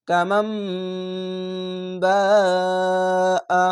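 "কামাম বা আ" with hummed m and very long বা; four-alif stretch. Shown as a demonstration. Teacher puts the word together for learners, long drawn. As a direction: recite it slowly, holding m, then বা, brief আ. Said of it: "চার আলিফ টেনে পড়বেন".

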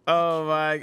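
ordinary talk

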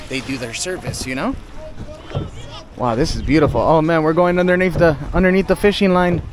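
usual speaking voice

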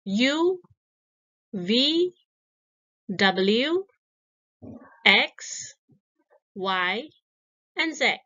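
clear, paced classroom recitation; recited letters. Shown as a demonstration. Teacher reciting letters for young pupils, clearly. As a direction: model each letter for the class.

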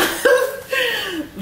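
sudden high-pitched laugh; a squeaky burst of real laughter. Real, not acted. Laughing at herself for not asking for the hospital, high laugh.

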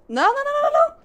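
very high, rapid run of no's; tiny high-pitched panicky stutter; panicked. Real, not acted. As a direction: squeal repeated no's in alarm. Panics during the race.

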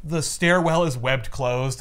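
ordinary talk, nothing marked

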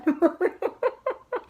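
loud high laugh; cracking up at the memory; real laughter bursting out. Real, not acted. Laughs after the story.

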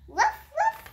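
high puppy yap. Acted. Child reading a puppy book, high puppy yap. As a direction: yip it high like a small dog.

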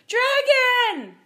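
very high, loud scream; shrieked alarm from a crowd. A narrator's reading. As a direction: scream it in panic. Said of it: "They screamed".

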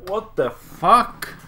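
high, loud, slow drawn-out what the fuck; stunned disbelief. Real, not acted. Player stares at the screen, loud and slow, stunned. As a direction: stretch it out in disbelief.

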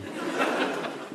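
a quick breathy chuckle; short laugh. Real, not acted. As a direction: let a real laugh slip out. Narrator amused by own description.

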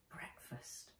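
unvoiced whisper of the word. A narrator's reading. Nat smells bread and thinks of breakfast, whisper. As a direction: breathe it like a secret wish.

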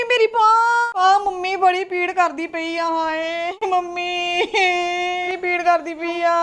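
slow, sing-song hammed-up moaning; faked arm pain. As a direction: whine as if the arm really hurts. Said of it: "ਹੁਣੇ ਤੇਰੀ ਬਾਂਹ ਪੀੜ ਹੋਣੀ �".

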